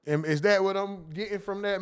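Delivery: usual speaking voice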